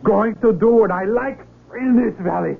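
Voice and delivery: high, rising defiant cry; defiant shout. Acted. Carlos defiantly shouting at the villagers, high and strained. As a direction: shout your defiance at them.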